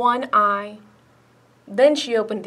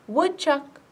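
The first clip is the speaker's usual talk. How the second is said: higher-pitched, gentle call; 4 semitones higher than usual. A narrator's reading. Turtle on Woodchuck's hill, mildly calling. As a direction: call a friend's name without shouting. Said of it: "called Turtle".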